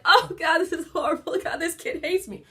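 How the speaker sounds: high, loud panicked new parent wailing; mock panic. Mother mimicking her early panic with a new baby, hammed-up high wail. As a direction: play the overwhelmed new mom losing it.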